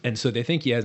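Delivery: ordinary delivery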